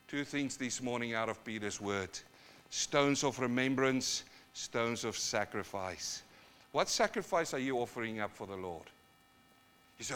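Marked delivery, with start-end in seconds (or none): none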